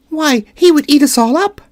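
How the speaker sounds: high, sudden squeak of fear; a tiny queen startled by danger; alarmed mouse queen. Acted. Queen afraid of lion.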